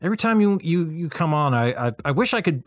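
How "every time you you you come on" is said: usual speaking voice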